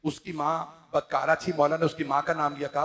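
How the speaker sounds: ordinary talk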